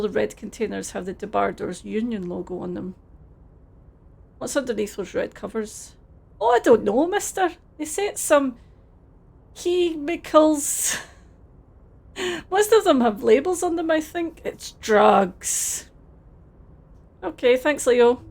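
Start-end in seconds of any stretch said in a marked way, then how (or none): 6.4-15.9 hammed-up Scottish dockworker, a touch higher and cheerful; put-on Scottish accent, friendly and a bit overdone; terrible Scottish accent. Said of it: "please enjoy my terrible Scottish accent".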